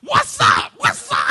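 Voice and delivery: very high, loud challenge to fight; furious shout. Retold or imitated. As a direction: roar it in his face ready to brawl. Squaring up for a fight.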